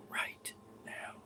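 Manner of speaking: breathy whisper, barely there. Retold or imitated. Ghost hunter imitating a faint whisper. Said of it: "she didn't say it like that".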